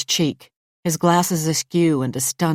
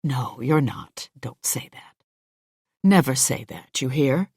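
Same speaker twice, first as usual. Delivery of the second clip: reassuring; 2 semitones lower than usual. A narrator's reading. Boss comforts her softly.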